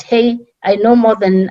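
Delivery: ordinary talk, nothing marked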